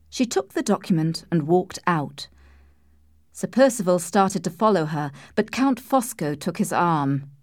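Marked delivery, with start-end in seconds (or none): none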